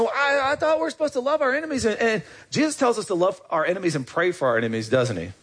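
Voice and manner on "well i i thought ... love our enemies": much higher, fast, whiny objection; high quick mock protest. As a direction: play the indignant churchgoer. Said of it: "we may be saying".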